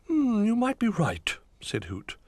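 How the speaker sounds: high, loud owl voice; a kindly owl calling down. A narrator's reading. Hoot greets Little Bear, high and loud.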